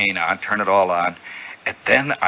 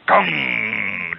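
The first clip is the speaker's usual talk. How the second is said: ringing "gong" of a radio signal; imitated carrier signal. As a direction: mouth the hum of an incoming signal.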